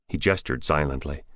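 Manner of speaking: usual speaking voice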